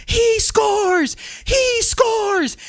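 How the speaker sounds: high, loud sportscaster's goal cry; goal-call shout. Shown as a demonstration. Coach showing a caster the goal call, high excited shout. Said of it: "I do it three times".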